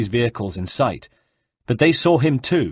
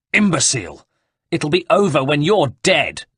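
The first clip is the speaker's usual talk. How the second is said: higher-pitched, scornful anger; angry retort; 5 semitones higher, 3 dB louder than usual. A narrator's reading. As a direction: throw the insult back at an enemy. Furious villain retorts in sword fight.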